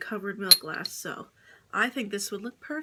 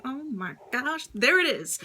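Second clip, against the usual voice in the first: excited; 4 semitones higher, 3 dB louder than usual. Retold or imitated. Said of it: "I was like".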